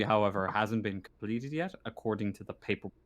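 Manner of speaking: ordinary delivery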